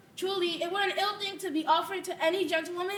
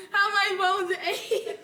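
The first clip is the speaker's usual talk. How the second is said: aching complaint; 5 dB louder, slower than usual. Acted. Nurse complains of aching bones.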